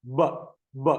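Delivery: short "ബ", not the letter name; letter B sound. Shown as a demonstration. Teacher isolating the first sound of balloon, short separate "ബ". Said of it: "ബിയുടെ sound".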